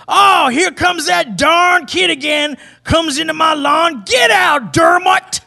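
high, drawn-out cranky yell; hammed-up high-pitched shouting; imitated shout. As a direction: holler it like a grouch chasing a kid off the lawn. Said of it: "Steve shouts".

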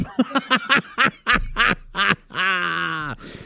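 high, helpless run of laughter; long laugh. Real, not acted. Uncle recalling niece's rude offer, long laugh. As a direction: crack up at your own punchline.

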